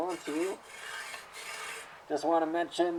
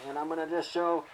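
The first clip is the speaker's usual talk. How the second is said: usual speaking voice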